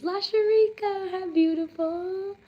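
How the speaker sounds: high, bright-eyed hello to a viewer; delighted greeting. Real, not acted. Streamer gushing over a viewer's name, delighted. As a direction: gush over the name, lifting way up.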